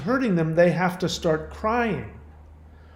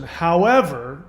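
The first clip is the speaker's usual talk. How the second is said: "However" stretched slow with a rising tune; drawn-out however; 3 dB louder, slower than usual. Retold or imitated. Host mocking caller's turn to blame, drawn-out "However". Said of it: "meaning, now forget what I just said".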